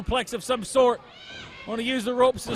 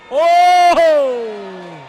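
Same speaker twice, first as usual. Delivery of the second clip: shocked exclamation; 7 dB louder than usual. Real, not acted. Crowd erupts, commentator reacts.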